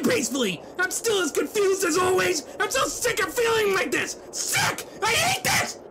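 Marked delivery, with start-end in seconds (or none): 4.3-5.8 high cracking yell of frustration; much higher strained cry; frustrated outburst. Acted. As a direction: let it burst out of you. Character breaks down in frustration.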